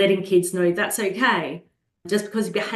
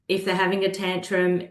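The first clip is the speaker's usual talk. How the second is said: usual speaking voice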